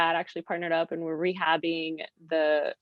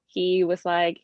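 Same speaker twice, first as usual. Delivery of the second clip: usual speaking voice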